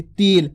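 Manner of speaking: तिल pulled out into "teeel"; drawn-out long vowel. Shown as a demonstration. Teacher showing the long vowel mark, stretching तिल. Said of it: "ता का उच्चारण खींच के कर रहे".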